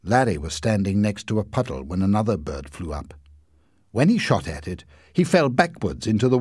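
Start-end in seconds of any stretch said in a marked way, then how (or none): none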